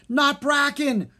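slow anguished high yell. Acted. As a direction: scream in horror for his homeland. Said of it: "Drake yelled".

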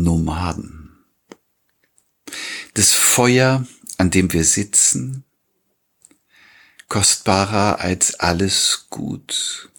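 slow poem recital with long pauses; Gedichtvortrag. Acted. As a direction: read the verse deliberately, phrase by phrase. Reads the poem aloud. (getragen gelesenes Gedicht)

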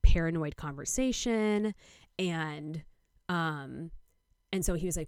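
ordinary delivery

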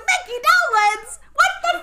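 shocked shout at the screen, high and loud; outraged shout. Retold or imitated. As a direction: yell out disbelief. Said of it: "I was like".

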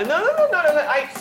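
high laughing no; laughing denial. Acted.

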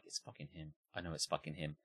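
low, very soft angry aside; seething undertone. Retold or imitated. Angry theatregoer to himself about the whistler, seething undertone. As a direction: grumble it privately under your breath.

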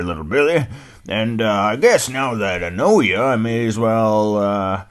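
put-on puzzled old dog grumbling; old hound dog. Old hound dog puzzling over the name, loud and folksy.

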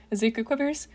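plain reading voice

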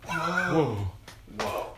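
an awestruck actor's "whoa"; Keanu Reeves. Acted. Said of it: "that's my Keanu Reeves impression".

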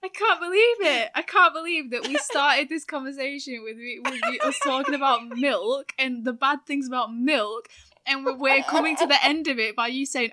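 loud, high, laughing disbelief. Real, not acted. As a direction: exclaim it in shock, half laughing. Shocked by cow slaughter reveal.

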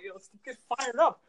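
high-pitched reenacted hype call; rally them like a pep talk; fired up. Teen rallying schoolmates in the hallway, fired up. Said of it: "that's what I'm like".

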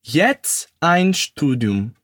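slow, careful German phrase; slow model repetition. Shown as a demonstration. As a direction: model it slowly for learners. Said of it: "Repete comigo". (pronúncia lenta e didática)

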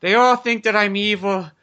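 put-on Italian accent. Landlord complains to his boy about tenants, in an Italian accent. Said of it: "I don't know why he sounds fucking Italian now".

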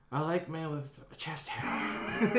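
slow breathy whisper with a laugh; sultry whisper. Retold or imitated. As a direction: whisper it seductively and slowly. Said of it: "you're gonna call".

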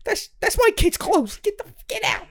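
hammed-up outraged father; imitating a panicked dad ordering someone off; frantic yelling dad. Husband yelling, quoted in recap.

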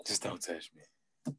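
exhausted player begging to be left alone, very soft; quiet plea. Retold or imitated. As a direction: fade to almost nothing. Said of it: "I said".

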